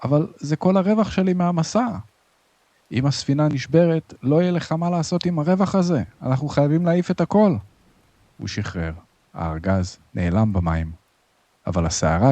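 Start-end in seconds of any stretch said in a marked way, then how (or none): 0.0-1.9 high, pleading objection; distressed protest. A narrator's reading. Sailor protests losing his cargo. 2.9-7.6 high, pressing insistence; urgent argument. A narrator's reading. Mate argues urgently in storm.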